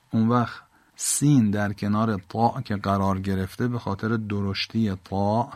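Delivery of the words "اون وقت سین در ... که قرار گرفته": normal talking voice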